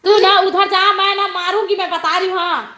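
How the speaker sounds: exasperated mother's telling-off. Real, not acted. Mother scolding child mid-livestream.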